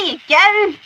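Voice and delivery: high, loud put-on regional accent; exaggerated regional British accent; [name]. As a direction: call it out in a hammed-up regional accent. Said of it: "regional accents coming from [name] here".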